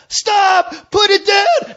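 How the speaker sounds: high-pitched retold police shout; cop's shouted order. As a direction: shout it like cops charging out. Cops charging out, shouting orders.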